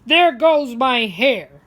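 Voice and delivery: loud, slow grumpy complaint; grumpy old man. Acted. Old man fighting his overcoat, loud and slow. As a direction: grumble it like a fed-up old man.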